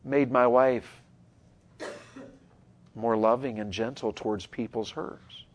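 ordinary talk, nothing marked

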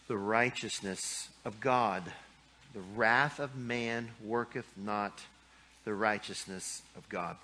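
slow, soft scripture recitation; scripture reading. Real, not acted. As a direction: let the verse settle slowly. Preacher quotes scripture twice.

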